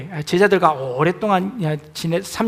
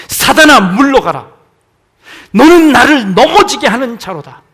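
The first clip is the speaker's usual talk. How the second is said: much louder, higher ringing shout; fierce rebuke; 5 semitones higher, 14 dB louder than usual. Acted. Preacher repeating Jesus's rebuke, full shout. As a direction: thunder the rebuke.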